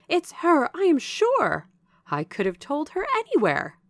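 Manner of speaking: loud, high-pitched exclamation of recognition; excited cry. A narrator's reading. Servant recognizing Jane as she runs out, excited cry. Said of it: "cried the individual".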